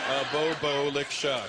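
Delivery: lightly voiced Jamaican accent, laughing; a hint of a shy Jamaican patois; passive Jamaican impression. Passive Jamaicans mumbling patois, light Jamaican accent. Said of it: "They're like".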